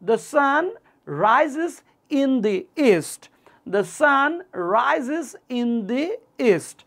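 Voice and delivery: The sun, rises, in the east, with pauses between; word by word. Shown as a demonstration. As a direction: split it into slow chunks. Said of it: "teacher models word by word".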